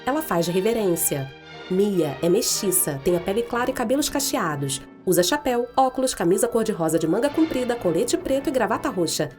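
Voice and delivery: usual speaking voice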